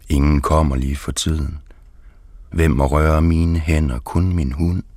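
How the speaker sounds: ordinary delivery, nothing marked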